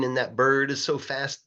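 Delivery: ordinary delivery, nothing marked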